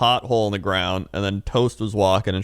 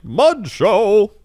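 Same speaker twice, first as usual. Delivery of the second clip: high, loud carnival cry; showman announcing; 11 semitones higher, 4 dB louder than usual. Retold or imitated. Mud performer revealing his towel to the crowd, loud and high. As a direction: bellow it like a showman unveiling.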